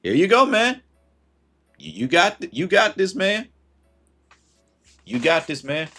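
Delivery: high, bright encouraging handoff; cheery hand-back. Retold or imitated. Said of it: "I said".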